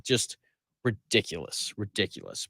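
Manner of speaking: low, soft dismissal; disgusted aside. Real, not acted. Radio host writing off the local media, quietly disgusted. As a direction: drop the voice right down in disbelief.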